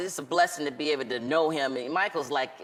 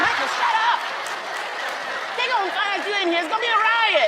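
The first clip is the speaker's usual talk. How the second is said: mock urgent scold; 4 dB louder than usual. Hushing Michael in theater.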